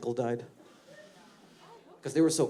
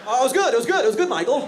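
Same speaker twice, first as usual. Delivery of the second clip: very high, loud forced cheer; high, loud fake cheer; fake-friendly coworker; 13 semitones higher, 9 dB louder, faster than usual. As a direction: overdo the politeness to hide the gossip.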